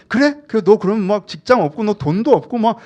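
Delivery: loud, high, agitated challenge; fear raising a ruckus. Acted. Fear confronts someone wanting security, loudly making a fuss. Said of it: "막 이렇게 막 난리를 쳐요".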